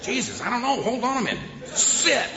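flustered owner. Retold or imitated. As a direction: fumble to settle the dog. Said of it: "It's like".

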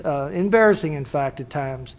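ordinary delivery

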